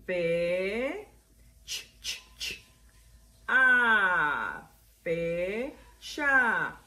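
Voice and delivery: "fecha" built from long fe, repeated ch, long a; sounding out. Shown as a demonstration. Teacher writing the word, "fecha" sounded out piece by piece. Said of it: "ok, escribimos".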